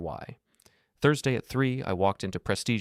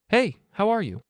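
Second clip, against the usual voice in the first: much higher, louder falsely cheerful phone greeting; bright and false; 13 semitones higher, 6 dB louder than usual. A narrator's reading. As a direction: sound brightly fake to a friend on the phone. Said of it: "bright and false".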